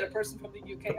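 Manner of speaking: hushed reaction. Acted. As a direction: drop the volume on the acted realization. Same reaction repeated, dropped low.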